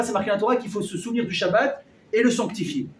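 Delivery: ordinary delivery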